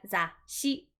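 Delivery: "za, shi" with a silent gap before "shi"; true pause. Shown as a demonstration. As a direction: stop dead after "za", then say "shi". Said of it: "with a true pause".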